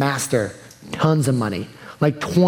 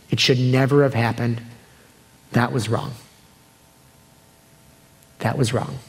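sincere comfort; 3 semitones lower than usual. Real, not acted. Pastor consoling hurt listeners.

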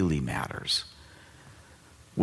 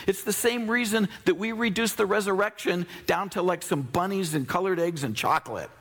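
preaching at full force. Acted. Pastor preaching to the congregation, full force. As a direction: preach it out to the crowd.

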